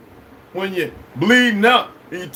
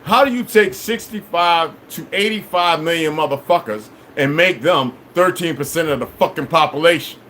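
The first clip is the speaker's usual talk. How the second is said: ordinary delivery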